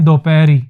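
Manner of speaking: दोपहरी with a long o and trailing ee; stretched "do-pe-ree", ending on ee; wrong form dopaheri. Shown as a demonstration. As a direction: show the mistake, दोपहरी said as "do-peri". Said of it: "गलत है".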